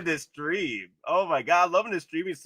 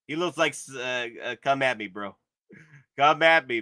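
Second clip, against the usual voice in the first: usual speaking voice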